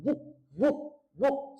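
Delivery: बुक said in one short beat; single syllable. Shown as a demonstration. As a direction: say बुक all at once, unbroken. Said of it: "इसको एक साथ में ही बोला".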